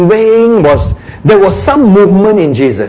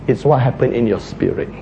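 ordinary talk

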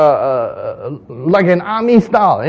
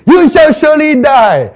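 high, loud doom declaration; booming prophecy; 11 semitones higher, 9 dB louder than usual. Acted. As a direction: thunder the verdict. Prophet's doom message acted.

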